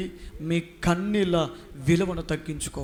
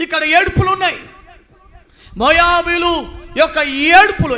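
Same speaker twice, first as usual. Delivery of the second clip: shouted preaching; 10 semitones higher, 10 dB louder than usual. Acted. Preacher crying out to the church about Moab's weeping.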